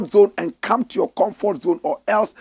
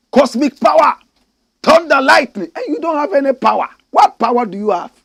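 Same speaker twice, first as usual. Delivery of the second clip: loud, high-pitched taunt; mocking outburst; 8 semitones higher, 7 dB louder than usual. Commentator taunts the chiefs over their cosmic power, mocking outburst. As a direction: belt out the grand claim, then scoff.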